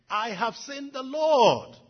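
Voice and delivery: high, very loud and very slow, rapturous; exalted cry. Acted. Mary returning to the disciples, exalted proclamation. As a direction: let the joy ring out. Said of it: "how exalted she must have felt".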